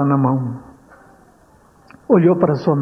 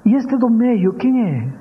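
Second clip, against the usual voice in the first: put-on child asking; 7 semitones higher than usual.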